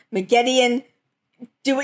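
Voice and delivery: ordinary delivery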